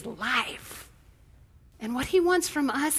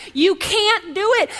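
ordinary delivery

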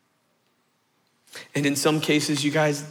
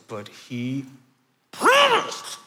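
put-on "promised" jumps way up in a kid's wail; whiny kid protest; much slower than usual. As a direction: wail it like a let-down little boy. Little brother protesting the broken promise.

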